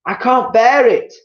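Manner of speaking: wife overcome with fear; Missus Twit crying out. Acted. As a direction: wail it in terror. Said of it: "cried Missus Twit".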